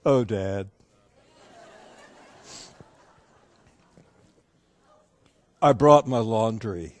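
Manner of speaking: low, hesitant imitated daughter, long pause between; daughter's deadpan reply. Imitated daughter admitting to her dad why she came, low reply. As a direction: drop low and confess after a pause. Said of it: "And this is how she".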